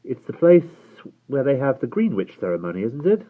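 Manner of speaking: diffident. A narrator's reading. Will suggesting an answer about the place, diffidently. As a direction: offer it hesitantly, seeking agreement. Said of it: "Will said diffidently".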